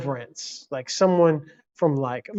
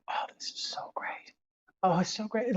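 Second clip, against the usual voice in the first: hushed, delighted whisper; retold reaction, whispered with relief at sounds of home; awed whisper; 5 semitones higher, 10 dB quieter than usual. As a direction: whisper it to yourself in wonder.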